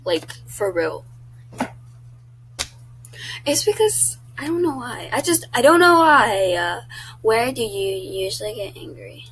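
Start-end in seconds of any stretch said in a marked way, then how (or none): 3.4-6.5 flustered outburst. Real, not acted. Flustered over her anger.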